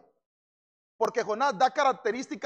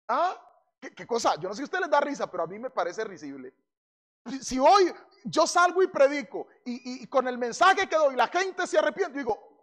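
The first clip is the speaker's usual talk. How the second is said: ordinary talk, nothing marked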